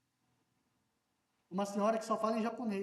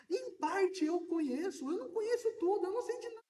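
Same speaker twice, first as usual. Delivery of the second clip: rising emphasis; 9 semitones higher than usual. Acted. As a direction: voice climbing much higher on each phrase. Cita Coríntios com voz subindo. (voz subindo cada vez mais alto)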